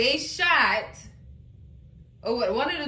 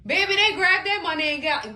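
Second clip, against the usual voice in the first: ordinary delivery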